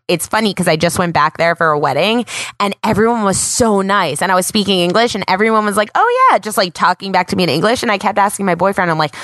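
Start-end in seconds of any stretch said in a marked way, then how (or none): 5.9-6.4 cheery Parisian reply. Retold or imitated. Imitating friendly Parisians.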